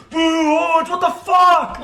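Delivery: boo like an angry spectator; outraged arena crowd yelling; jeering crowd. Acted. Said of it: "you hear people going".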